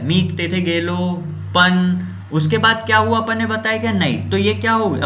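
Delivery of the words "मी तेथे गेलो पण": very slow, पण left hanging; trailing off. Shown as a demonstration. Teacher repeating the broken-off example, very slow and trailing. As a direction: let the words fade and stop on पण. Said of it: "अब उसके आगे अपन बताए नहीं, रुक गए".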